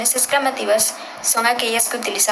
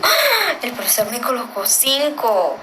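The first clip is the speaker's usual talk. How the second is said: surprised exclamation; 3 semitones higher than usual. Shown as a demonstration. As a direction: act out delighted surprise at the grade. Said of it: "es como de sorpresa".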